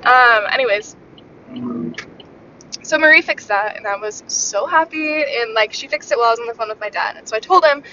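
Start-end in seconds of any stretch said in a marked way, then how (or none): none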